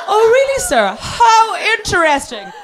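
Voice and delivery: very high, slow, strained politeness; feigned interest. Retold or imitated. Sick passenger pretending to listen, over-brightly. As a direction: overdo fascination to cover up being sick.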